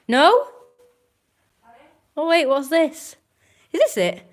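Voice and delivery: high, loud squeals of delight; thrilled, high-pitched cooing; excited discovery. Real, not acted. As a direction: gush over a cute find. Excited discovery of lemon bites.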